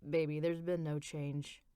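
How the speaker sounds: low, soft and halting, lightly voiced husband; husband breaking bad news to his wife, hesitant and soft. A narrator's reading. Faltering low husband voice. Rich continues.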